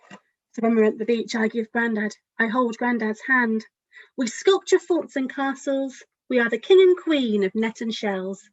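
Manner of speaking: gentle picture-book storytelling; lively, caring storybook narration; reading to children. A narrator's reading. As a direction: read it as if to a room of small children. Author reads her picture book aloud.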